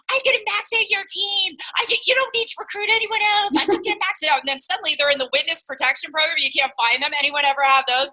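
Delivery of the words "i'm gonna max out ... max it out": much higher, cocky and brash, partly rushed; cocky new recruit. Retold or imitated. New recruit boasting to their upline, high cocky brag. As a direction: play the overeager bright spark bragging. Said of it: "And they're like".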